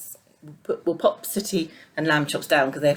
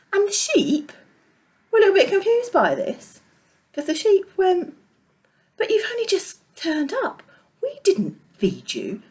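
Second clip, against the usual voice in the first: high, confused sheep; 14 semitones higher, 3 dB louder than usual. Acted. Confused sheep voiced in story.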